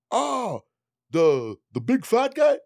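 high, slow surprised friend's voice; mock-baffled drawn-out question. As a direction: friend asking who's on the call, high and slow. Friend's surprised quote in story.

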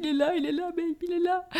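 high-pitched mock-weepy new father; imitating tearful joy. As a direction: overcome partner, voice shooting up high. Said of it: "mon homme pleurait". (imitation d'un papa en pleurs, voix très aiguë)

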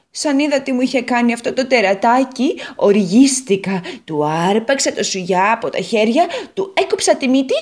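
the potato boasting how she got even; Potato character voice. Acted. Potato tells her revenge.

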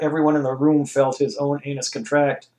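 plain reading voice

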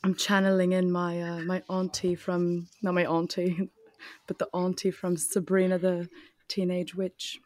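put-on British accent; playful, composed British voice; British auntie. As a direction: channel a TV witch auntie in British. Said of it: "That's who I imagine in my head".

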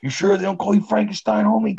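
very high, loud mocking challenge; suspicious tough guy. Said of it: "That dude's like".

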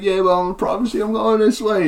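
swagger it like a washed-up rock star; laid-back bard introducing himself, cocky. Acted. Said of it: "he's like".

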